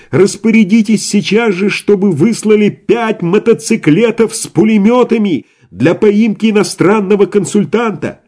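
very high urgent phone shout. A narrator's reading. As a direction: yell orders down the line. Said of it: "закричал Иван в трубку". (громкий крик в телефон)

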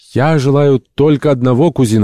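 high, loud, fervent declaration; ardent. A narrator's reading. Ardent entomologist answers his cousin about his one wish, fervently. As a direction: declare it with passion. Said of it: "ответил пылкий энтомолог".

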